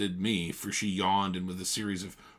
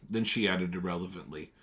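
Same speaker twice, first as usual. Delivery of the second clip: ordinary delivery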